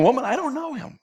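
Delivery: a quick, high dismissal, no shouting; simple denial. Acted. Peter brushing off the servant girl, a simple denial. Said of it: "probably said it about like that".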